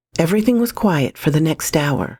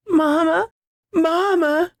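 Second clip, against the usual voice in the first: put-on high, crying child voice calling; slower than usual. A narrator's reading. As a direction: wail for your mother like a lost child. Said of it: "while still crying out".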